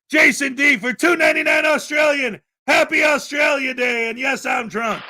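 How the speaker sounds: loud, high hyped announcement; host hyping a donor's boozy Australia Day message, loud and excited; excited shout. Acted. Reading donor message with fanfare.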